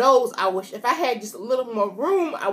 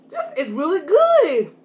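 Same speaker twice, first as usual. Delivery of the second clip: excited praise; 6 semitones higher, 6 dB louder than usual. Real, not acted.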